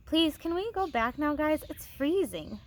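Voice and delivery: put-on lightly whiny, pleading girl; a mild whine to go back; whining. A narrator's reading. Girl begging the others to go back, mildly whiny. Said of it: "whined Sherilyn".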